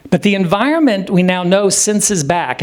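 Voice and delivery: normal talking voice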